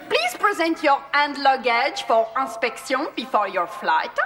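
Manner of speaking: normal talking voice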